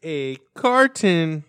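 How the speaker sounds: very slow, word by word; mock slow enunciation. Friend mocking the Florida store exchange, exaggeratedly slow. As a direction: stretch each word for someone who can't follow. Slow mimic for uncomprehending Southerner.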